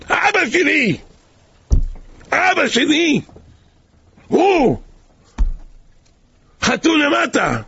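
high, anguished cries through tears; a father's sobbing discovery; sobbing cry. Acted. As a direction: cry out to the father's name, breaking with grief. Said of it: "הוא מתייפח".

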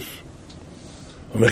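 normal talking voice

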